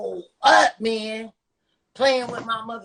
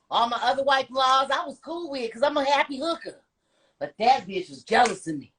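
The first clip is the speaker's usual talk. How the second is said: normal talking voice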